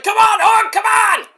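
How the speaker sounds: high, loud fan cheer; cheering crowd. Retold or imitated. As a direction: yell it like a fan begging him on. Fans going nuts cheering.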